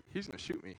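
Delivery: soft, low worried sister; sister's worry. Retold or imitated. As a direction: quietly voice her fear. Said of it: "She's like".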